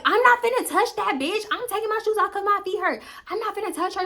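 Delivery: high, frantic protest; insisting to friends, pitched high; protesting innocence. Retold or imitated. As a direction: squeak out the denial. Defending herself in the brawl.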